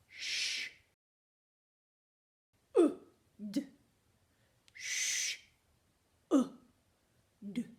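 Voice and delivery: "Sh. Uh, d." as separate sounds with gaps; sounding out. Shown as a demonstration. Teacher segments "Sh. Uh, d." for spelling, one isolated sound at a time. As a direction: say each sound of "Sh. Uh, d." alone, long hissed sh and short uh and d.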